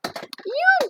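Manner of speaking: sudden high yelp as she slips; a surprised little cry, almost falling; startled yelp. Real, not acted. Losing balance. (petit cri de surprise en perdant l'équilibre)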